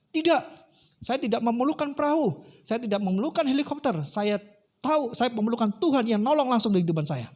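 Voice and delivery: high-pitched acted protest; stubborn refusal. Man stubbornly refusing the helicopter. (penolakan ngotot bernada tinggi)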